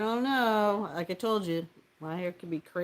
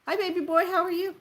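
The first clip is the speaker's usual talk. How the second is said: cutesy greeting; 9 semitones higher, 3 dB louder, faster than usual. Real, not acted. Greeting a viewer called baby boy, cutesy higher coo.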